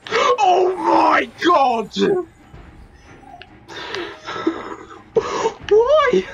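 long, high cry of dismay; frightened wail. Real, not acted. Player scared by the game, frightened wail.

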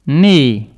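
"Knee" loud, with the K left out; silent K. Shown as a demonstration. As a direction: pronounce "Knee" from the N onward. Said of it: "On ne prononce pas le K".

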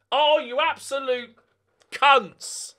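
high, loud angry outburst; real rage at the opponents; furious cursing. Real, not acted. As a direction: spit it at the screen. Cursing the opposing team.

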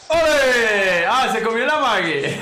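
triumphant shout. Real, not acted. Streamer lands a clean hit, loud triumphant cheer.

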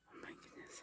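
barely there, awed whisper; overcome whisper. Real, not acted. Seeing bees on the outfit, overcome whisper. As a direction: whisper it under your breath in awe. Overcome while opening gift.

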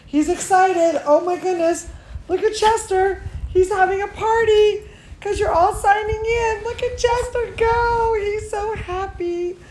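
high-pitched, delighted squealing; excited gushing. Real, not acted. Gushing over Chester's excited antics.

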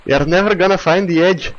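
put-on pirate captain taunting across the water in a pirate accent. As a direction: sneer it at the rival captain like a storybook pirate. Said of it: "I made this accent for a pirate".

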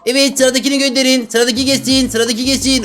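high-pitched mock call for the next patient; doctor calling patients.

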